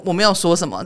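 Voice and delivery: ordinary talk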